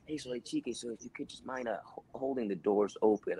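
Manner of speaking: quiet correction. Retold or imitated. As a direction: pull him aside gently. Director correction, much quieter.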